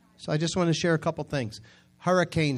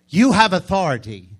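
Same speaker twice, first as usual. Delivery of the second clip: louder, higher declaration; preaching emphasis; 5 semitones higher, 10 dB louder than usual. Real, not acted. Preacher telling the congregation, loud and proclaiming. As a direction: proclaim it with full force.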